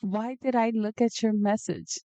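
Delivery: ordinary talk, nothing marked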